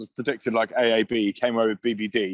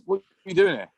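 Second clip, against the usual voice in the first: higher-pitched mock Kiwi question; badly imitated Kiwi accent; 5 semitones higher than usual. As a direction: imitate a puzzled coach with a rough New Zealand accent. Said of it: "we're bad at accents as well".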